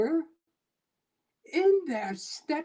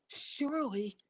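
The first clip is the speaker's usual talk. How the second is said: hushed; 10 dB quieter than usual. A narrator's reading. Poem narrator voices himself.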